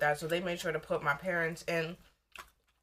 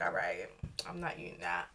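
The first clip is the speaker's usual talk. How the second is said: low mutter; 12 semitones lower, 8 dB quieter than usual. Retold or imitated. Retold self-talk, dismissive.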